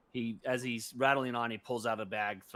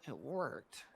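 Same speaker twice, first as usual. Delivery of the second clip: mild sadness; 3 semitones higher, 4 dB quieter than usual. Acted. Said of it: "you're sad that it worked".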